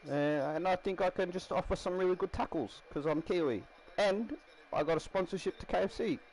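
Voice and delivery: high-pitched put-on Kiwi voice; Kiwi accent. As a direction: sell yourself in a high mock Kiwi accent. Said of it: "yeah, he's Kiwi".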